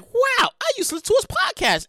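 high, amazed listener; impressed listener. Pretend. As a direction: play an amazed fan. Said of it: "And you're like".

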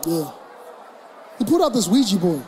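usual speaking voice

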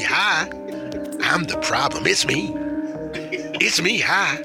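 ordinary talk

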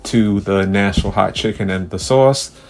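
big booming show host voice; announcer. Pretend. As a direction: play the grand host welcoming fans. Grand mock podcast host intro.

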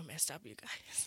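a real whisper dropped into the chat; breathy confiding whisper; whispered aside. Real, not acted. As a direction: lean in and whisper it to friends. Confiding aside about producers.

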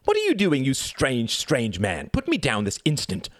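high-pitched imperious outcry; crying out. A narrator's reading. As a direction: protest indignantly as a child being carried. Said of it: "Reginald cried out".

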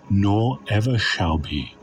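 ordinary talk

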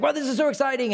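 high, loud gushing enthusiasm; overeager, hammed-up thrill; mock excitement. Shallow-soil hearer gushing.

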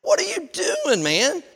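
high incredulous what are you doing; mock disbelief. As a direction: act baffled at the soaking wood. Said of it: "and I'm like".

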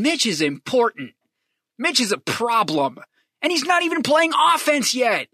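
exasperation climbing to a high pitch; real fan frustration, rising; getting emotional. Real, not acted. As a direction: build to an incredulous high-pitched complaint. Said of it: "I'm sorry I got emotional".

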